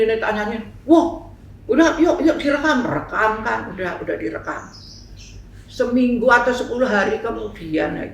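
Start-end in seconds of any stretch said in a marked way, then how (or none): 0.8-2.8 eager. Retold or imitated. Eager to record the song.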